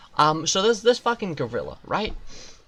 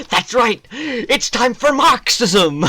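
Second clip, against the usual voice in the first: rallying cry; 7 semitones higher, 8 dB louder, slower than usual. Acted.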